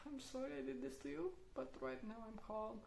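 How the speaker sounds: put-on quiet calm apology to the child; calm soothing. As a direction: reassure the child softly. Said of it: "I'm like".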